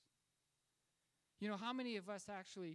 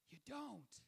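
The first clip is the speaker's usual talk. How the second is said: higher-pitched, incredulous question; flabbergasted; 4 semitones higher than usual. Retold or imitated. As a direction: act out astonished disbelief. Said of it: "Einstein was flabbergasted".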